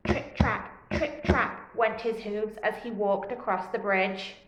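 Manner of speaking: low, soft storybook narration; story narration. Acted. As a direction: read it like a picture-book recording.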